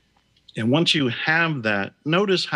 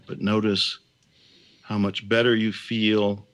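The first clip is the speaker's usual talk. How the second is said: guided meditation; 3 semitones lower than usual. Acted. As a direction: ease them gently back.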